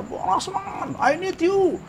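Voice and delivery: very high, held-back mock scream; imitated screaming. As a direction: imitate a distant scream, high but soft. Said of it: "Jerit-jerit, jerit-jerit sekuat-kuatnya". (jeritan tiruan bernada tinggi tapi lirih)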